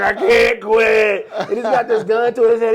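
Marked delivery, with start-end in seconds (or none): 0.0-1.3 slow, loud, hammed-up cry; mock desperate shout. Comedy sketch man with a gun to his head, in a slow, loud, hammed-up shout. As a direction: yell it slowly like a man who can't stop eating. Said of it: "he's like".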